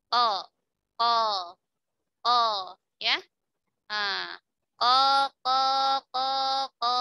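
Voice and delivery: Ko after Ko, steady and deliberate; qaf drill. Shown as a demonstration. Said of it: "Coba rasakan".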